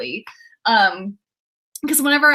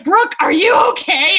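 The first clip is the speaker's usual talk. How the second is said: raised, louder mock-worried shout; all-caps alarm; 5 semitones higher, 6 dB louder than usual. Host reading a worried viewer's comment, mock alarmed shout. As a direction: read it out as if in capital letters. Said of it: "In all caps".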